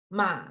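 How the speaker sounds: "ma" low, down in the throat; third tone. Shown as a demonstration. Recap of tones in order, "ma" low. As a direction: drop "ma" low and leave it there. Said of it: "Now we start again".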